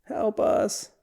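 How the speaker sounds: high, soft mock cry for mom; retold scream, hushed. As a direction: plead helplessly in a small, high voice. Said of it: "She screams".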